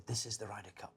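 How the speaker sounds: hushed, breathy whisper of the Ryder Cup; whispered passion, no accent; whispering Seve. Retold or imitated. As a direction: breathe it like a legend on his sickbed. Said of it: "I can't do an accent".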